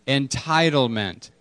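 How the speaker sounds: Entitlement spoken slowly, falling at the end; slow call and response. Shown as a demonstration. Pastor asking the room to say it again, slow. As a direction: say Entitlement slowly so they echo it. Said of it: "One more time".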